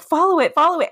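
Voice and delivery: high, urgent retold plea; excited urging. Retelling urgent plea to follow car.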